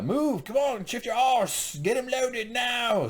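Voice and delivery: high, loud hammed-up commands; urgent play-acted shouting, last words stretched; mock yelled orders. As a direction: holler like a boss hurrying his men. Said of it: "he just yells at him".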